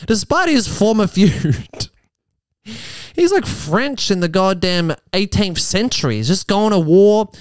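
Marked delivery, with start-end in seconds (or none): 0.1-1.9 slow, weighty mock read of the phrase; hammed-up tabloid reading; mock dramatic read. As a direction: savour each word like a headline. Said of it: "it's like".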